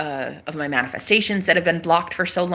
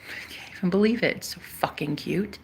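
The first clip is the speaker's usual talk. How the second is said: breathy whisper of delight; whispered gush; 8 dB quieter than usual. Real, not acted. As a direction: whisper it like a secret thrill. Gushing over her own nose.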